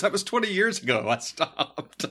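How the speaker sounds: exasperated retort. Retold or imitated. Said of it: "and he goes".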